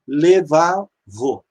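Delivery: Levavô said very slowly, syllable by syllable; slow model pronunciation. Shown as a demonstration. Teacher models Levavô slowly for students. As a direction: spell out Levavô slowly for the class. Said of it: "Ele vira shevá".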